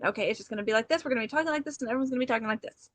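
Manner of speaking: fast and breathless, words tumbling out; hurried buzzy chatter with no pause for breath; rapid-fire talk. Shown as a demonstration. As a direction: rattle it off at a million miles a minute. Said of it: "now I'm gonna breathe 'cause that's not what we're doing".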